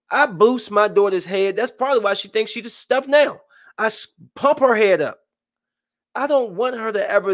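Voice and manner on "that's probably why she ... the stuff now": ordinary delivery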